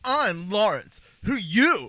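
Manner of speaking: loud, high, swooping retort; hammed-up hostile introduction; mock angry. One prisoner answering the other, exaggerated angry bark. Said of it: "why are you so angry at each other?".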